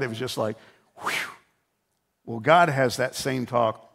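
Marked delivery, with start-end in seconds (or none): 1.0-1.1 imitated whoosh of relief; relieved exhale. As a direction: blow out air like a kid let off. Son relieved the talk is over.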